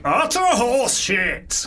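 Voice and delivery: high, drawn-out comic dismissal; scornful verdict. Pretend. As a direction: stretch it out in mock disbelief. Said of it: "it sounds like".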